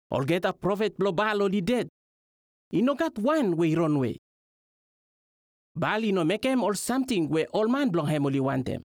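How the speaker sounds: normal talking voice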